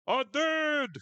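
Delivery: high, loud ghostly wail; Coach Martinez. Acted. Dead coach answering from the afterlife, comic high wail. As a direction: call out from beyond the grave. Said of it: "I can imagine the ghost of Co-, Coach Martinez going".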